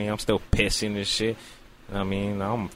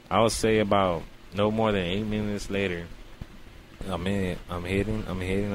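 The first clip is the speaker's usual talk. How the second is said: ordinary talk, nothing marked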